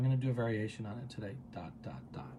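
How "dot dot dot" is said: very soft, low dot, dot, dot; focusing cue. Shown as a demonstration. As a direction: drop to a hushed, low murmur. Demonstrates the focusing exercise.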